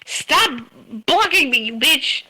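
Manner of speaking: high, loud shout at an opponent; sudden loud outburst of game rage; angry yell. Real, not acted. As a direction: yell it right at them, furious.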